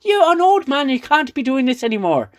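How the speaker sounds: high, loud hammed-up scolding lady; mock Romulan lady. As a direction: squeal it out like a panicked caricature. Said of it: "the Romulan lady's like".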